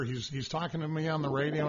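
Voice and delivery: ordinary talk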